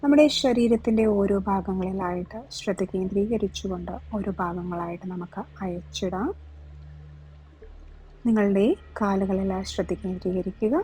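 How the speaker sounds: slow yoga nidra voice, drawn-out words and long pauses; guided relaxation. Real, not acted. Yoga teacher talking listeners through relaxing each body part, guided meditation. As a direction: lead the body to let go, unhurried, phrase by phrase.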